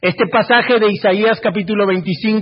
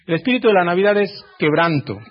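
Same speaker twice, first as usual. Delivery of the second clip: ordinary talk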